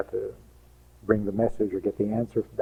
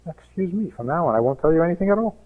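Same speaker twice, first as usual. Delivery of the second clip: quick, higher offended comeback; offended retort; 6 semitones higher than usual. Acted. Wife to reproaching husband, quick offended retort. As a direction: snap back, hurt and done sharing.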